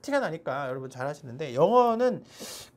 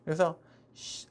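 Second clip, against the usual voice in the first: usual speaking voice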